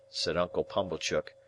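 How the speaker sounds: plain reading voice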